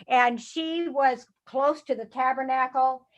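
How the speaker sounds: ordinary talk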